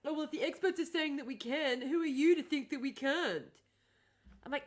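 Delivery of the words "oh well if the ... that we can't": put-on voice for naive critics; mocking other people. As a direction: imitate the smug doubters. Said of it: "all the people going".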